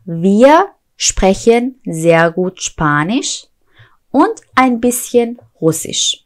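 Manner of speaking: slow, careful German; slow model sentence. Shown as a demonstration. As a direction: lay it out slowly. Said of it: "slow German model".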